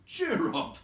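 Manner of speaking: rallying call. A narrator's reading. Gandalf rousing the dwarves, high rallying call.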